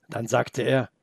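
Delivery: usual speaking voice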